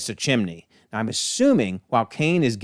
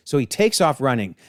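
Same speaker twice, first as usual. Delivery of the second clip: usual speaking voice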